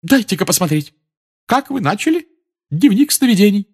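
high, brisk mystic teacher; demanding seer; Trelawney voice. A narrator's reading.

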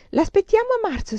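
high-pitched mocking quote of a professor; mimicked examiner. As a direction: parody the examiner's dismissal, voice lifted high. Student mimics dismissive examiner.

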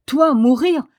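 high, loud, exultant disbelief; contained exultation. A narrator's reading. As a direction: scoff at it with suppressed triumph. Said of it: "avec une exultation contenue".

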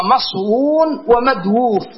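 "مصون ومدووف" slow, as maṣwūn and madwūf, both w's sounded; uncontracted forms. Shown as a demonstration. Teacher citing the two exceptional words, "مصون ومدووف" slowly as maṣwūn, madwūf. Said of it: "لا نظير لكلمتين في هذا الموضع".

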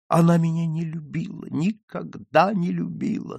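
high, insistent misery; despairing lament. Acted. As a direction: lament a lost love, voice lifted. Despairing lover laments to her father.

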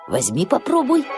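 ordinary delivery

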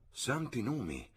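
high but soft exclamation; a hushed, high gasp of dismay in words; shocked cry. A narrator's reading. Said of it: "gridò la signora Honeychurch".

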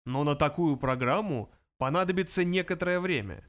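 ordinary delivery

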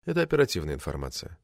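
soft, low, quick aside; quiet explanation. A narrator's reading. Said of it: "тихо объяснил из своего дальнего угла". (тихо, вполголоса)

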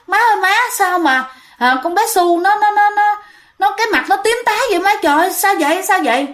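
high, fast frantic pleading; panicked. Retold or imitated. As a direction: cry out to mom in panic. Sister panics over blue baby. (giọng hoảng hốt gọi má)

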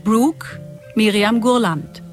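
ordinary talk, nothing marked

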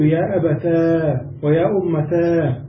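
slow, drawn-out -taa ending "أبتا" and "أمتا"; ta plus long alif. Shown as a demonstration. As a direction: stretch the last aa of "أبتا" and "أمتا". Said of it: "جمع بين التاء والألف".

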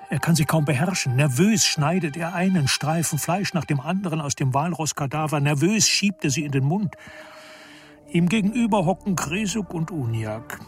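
ordinary talk

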